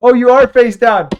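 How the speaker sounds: ordinary delivery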